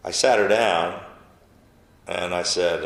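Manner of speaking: normal talking voice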